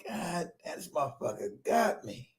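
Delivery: slow, hushed and low; stunned realization. Retold or imitated. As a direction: mutter it to yourself, beaten. Acted realization of being played.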